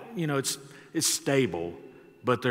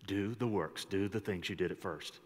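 quiet repeat; 4 semitones lower, 8 dB quieter than usual. Shown as a demonstration. Pastor softly repeating the verse to the church. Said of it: "And then repeat it".

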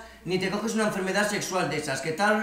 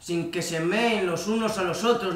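ordinary delivery

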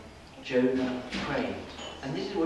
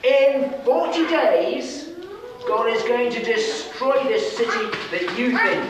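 prophet's shout; 9 semitones higher, 5 dB louder than usual. Acted. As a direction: call it out over the whole city. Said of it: "he shouted out".

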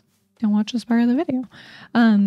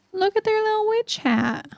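much higher, soft cooing over something cute; gushing over cuteness; 11 semitones higher, 4 dB quieter than usual. Real, not acted. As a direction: coo at it like a tiny pet.

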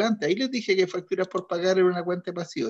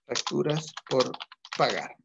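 drawn out slowly, word by word, as if writing it on the board; teacher dictating the entry to the class, slowly; slow dictation; 3 semitones lower, 4 dB quieter, slower than usual. Shown as a demonstration. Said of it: "Facturas por (repeated while writing)".

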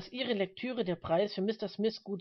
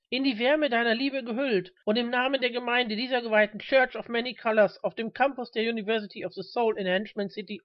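raised, louder preacher's oratory; preaching; 3 semitones higher, 5 dB louder than usual. A narrator's reading. Reverend welcoming the Lord before his flock, preaching loudly. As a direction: proclaim it fervently to the whole congregation.

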